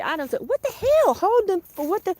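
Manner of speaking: high, loud sudden alarm; startled. Real, not acted. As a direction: blurt it out as you spot the damage. Finds something under the bed.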